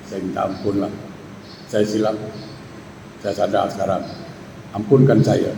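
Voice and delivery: soft imitated wife pleading for forgiveness; wife pleading softly. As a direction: beg quietly like a contrite wife. Wife begs husband's forgiveness.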